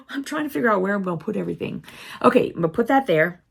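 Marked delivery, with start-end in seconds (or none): none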